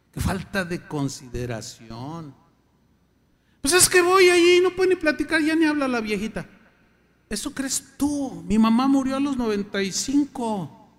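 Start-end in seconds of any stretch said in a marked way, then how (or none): 3.6-6.5 mocking excuse voice. Mocking excuse of neglectful child.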